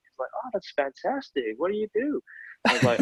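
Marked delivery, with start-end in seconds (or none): 0.3-2.2 high, exaggerated New York accent; Paula Scher. Designer chats to illustrator at traffic lights, put-on New York accent. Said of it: "That accent is amazing".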